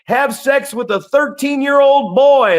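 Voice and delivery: high, loud mock announcement to the whole street; hammed-up shouted call-out, slowed at the start; imitating a scream-out. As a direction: call it out like a public alarm. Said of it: "I love watching him scream out".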